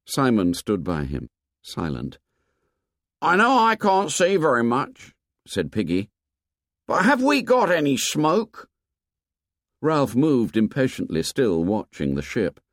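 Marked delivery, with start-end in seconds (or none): 3.2-8.7 put-on a worried boy squinting to see; Piggy. A narrator's reading. Piggy voiced by narrator.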